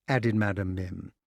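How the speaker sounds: plain reading voice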